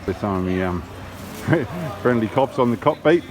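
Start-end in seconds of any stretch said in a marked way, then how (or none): 0.9-2.0 quick burst of laughter; short laugh. Real, not acted. Vlogger laughs at himself filming the friendly cops, a short burst of laughter. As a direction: laugh it off at yourself.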